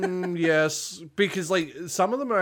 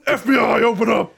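much higher, louder laughing call; mock FBI raid shout; 9 semitones higher, 10 dB louder than usual. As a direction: bark it like a raid at the door. Meme shout.

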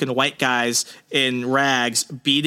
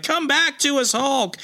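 high, strained plea to the monster; begging Hulk to return, voice up high; pleading villain; 11 semitones higher than usual. Retold or imitated. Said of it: "He's like".

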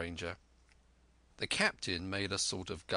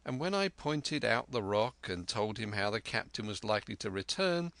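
usual speaking voice

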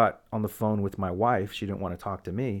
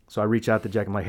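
ordinary talk, nothing marked

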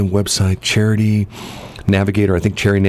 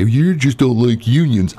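mocking critic; 6 semitones higher, slower than usual. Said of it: "Don't anybody say".

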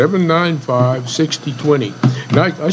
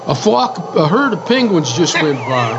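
mock surprise; 5 semitones higher than usual. Joking about penguins in desert.

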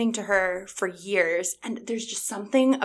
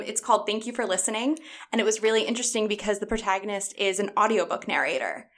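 ordinary delivery

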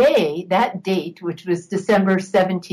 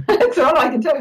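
laughing; 8 semitones higher, faster than usual. Real, not acted. Laughing after a strange healing.

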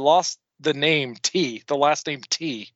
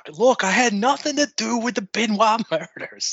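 high-pitched mock protest; Kevin Sullivan; 9 semitones higher than usual. Co-host extending the wrestler impression, high mock-defensive voice. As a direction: plead innocence in a put-on high voice. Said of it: "Now just talk about how".

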